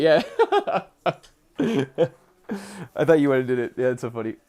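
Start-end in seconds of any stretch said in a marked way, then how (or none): 0.3-0.8 high squeal of laughter. Real, not acted. Laughing at own joke.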